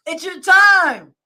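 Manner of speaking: slow, loud declaration; shout. Real, not acted. Same line shouted after laugh.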